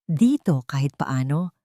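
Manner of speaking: plain reading voice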